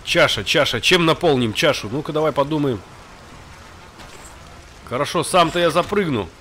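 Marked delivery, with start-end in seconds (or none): none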